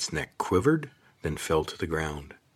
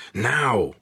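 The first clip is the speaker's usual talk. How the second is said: a sharp, loud, higher bark; barked command; 5 semitones higher, 6 dB louder than usual. Acted. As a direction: snap the order at the lizard. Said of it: "Kate barked".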